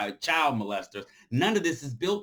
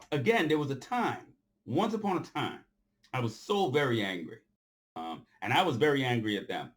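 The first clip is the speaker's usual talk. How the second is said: ordinary talk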